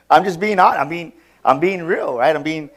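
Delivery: loud, laughing through the words; real laughter spilling into the confession. Real, not acted. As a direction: admit it while still laughing.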